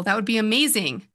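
usual speaking voice

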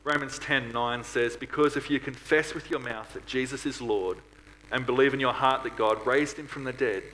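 normal talking voice

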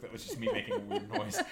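high laughing voice. Real, not acted. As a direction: laugh through the words. Laughing at own noise.

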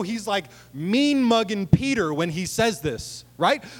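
ordinary talk, nothing marked

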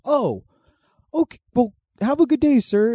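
very high, mock-polite retreat; crackhead impression. Crackhead told to stop coming over, very high polite impression. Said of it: "He's like".

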